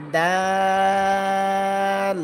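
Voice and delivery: each দাল held very long on a level note; slow recitation drill, light vowel in দাল; thin, drawn-out dal. Shown as a demonstration. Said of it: "দাল পাতলা হবে". (দাল পাতলা, টেনে টেনে)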